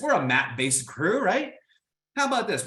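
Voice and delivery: ordinary talk, nothing marked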